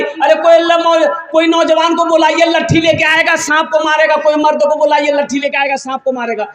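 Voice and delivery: high, panicky call for help, fading at the end; mock frightened shout. Women seeing a snake call for a man with a stick, high mock panic. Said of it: "तो औरतें चिल्लाने लगी".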